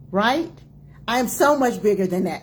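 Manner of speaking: usual speaking voice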